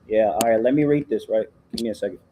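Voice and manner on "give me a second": ordinary delivery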